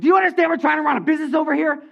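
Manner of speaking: high, loud angry outburst; acted indignant yelling; mad. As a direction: scold them as an irate businesswoman. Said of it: "Marie got mad. She was not happy".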